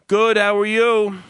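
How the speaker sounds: slow, loud, unbothered mob-guy greeting; tough guy reply. Retold or imitated. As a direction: imitate a gruff mobster answering without turning. Imitates mob guy's reply.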